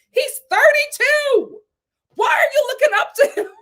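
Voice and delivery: high, loud disbelief breaking into laughter; incredulous yelling. Real, not acted. Host scolding the men for idolizing Jamie, high incredulous yelling. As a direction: holler it in sheer disbelief.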